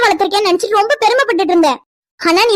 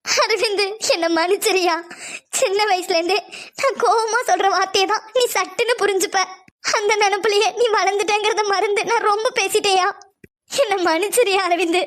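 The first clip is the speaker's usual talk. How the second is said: tearful apology; 7 dB quieter than usual. Acted. Aunt tearfully apologises to nephew.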